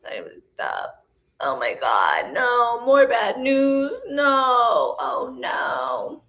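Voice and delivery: slow mock woe building to wailing cries of no; moaning Myrtle whine. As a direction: ham up a self-pitying victim who ends in sobbing shouts. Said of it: "Moaning Myrtle likes to be the victim".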